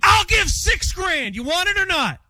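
exasperated, high-pitched haggling; yelling. Real, not acted. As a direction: yell it as an ultimatum. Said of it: "there's no yelling that goes on".

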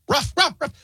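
high, short mock barks; imitated dog bark. Host imitating his dog at food time, mock barking. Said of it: "he starts barking".

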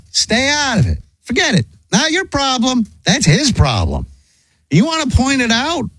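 high-pitched, emphatic telling-off; exasperated lecture. Retold or imitated. Husband teaching his wife to stay out, exasperated. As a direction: lay down the rule, voice straining upward.

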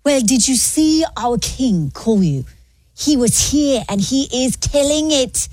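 put-on English accent. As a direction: demonstrate a drawn-out English accent. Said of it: "do an English accent".

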